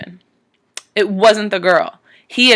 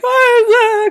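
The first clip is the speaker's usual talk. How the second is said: crying viewer; 4 dB louder than usual. Real, not acted. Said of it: "I'm, like, crying".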